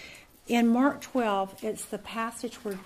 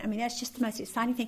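ordinary talk

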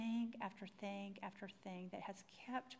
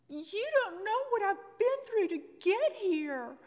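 loud, higher, slow pleading cry; desperate outburst; 11 semitones higher, 13 dB louder than usual. Retold or imitated. Telling the tour supervisor, a loud slow desperate outcry. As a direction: let it burst out, dragging each word. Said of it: "I said something that I didn't realize till later".

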